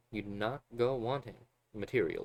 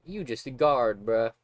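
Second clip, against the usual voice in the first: mocking the guard; 3 semitones higher, 9 dB louder than usual. Real, not acted.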